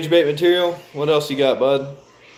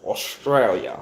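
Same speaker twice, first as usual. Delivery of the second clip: put-on Australia said slow and lower in an Australian accent, Ostrail-ya; 4 semitones lower, 4 dB quieter, slower than usual. Streamer imitates how Australians say Australia, in an Australian accent. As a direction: copy the Australian way of saying Australia. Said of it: "I like how Australians say the word Australia".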